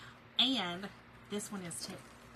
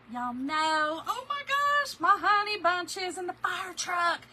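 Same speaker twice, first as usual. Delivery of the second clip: gushing delight; 8 semitones higher, 7 dB louder, slower than usual. Real, not acted. Gushing over cute stencil designs.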